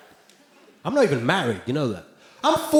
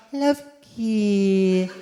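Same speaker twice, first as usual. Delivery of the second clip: [name] voice. Retold or imitated. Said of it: "What's with that voice?".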